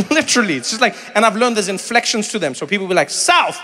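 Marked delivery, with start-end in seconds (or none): 3.1-3.6 South snapped short and high with a fall; clipped South. Shown as a demonstration. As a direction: say South quick and falling. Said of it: "they say it like that".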